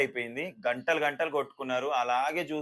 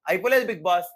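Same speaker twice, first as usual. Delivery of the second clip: louder cocky comeback; arrogant retort; 4 semitones higher, 7 dB louder than usual. Retold or imitated. Sreeja answering Bigg Boss back, louder and arrogant. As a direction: toss it back with attitude. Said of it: "అంత పొగరుగా attitude చూపించింది".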